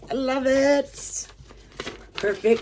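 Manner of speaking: real delighted gush; high excited squeal. Real, not acted. Admiring the key necklace.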